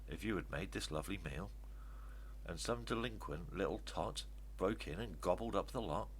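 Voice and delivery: ordinary delivery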